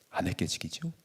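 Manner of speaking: hushed aside to the flock; whispered aside. Real, not acted. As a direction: drop to a whisper. (속삭이는 혼잣말)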